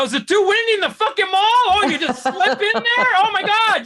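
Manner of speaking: outraged, mocking her excuse; outraged disbelief. Outraged at woman in door.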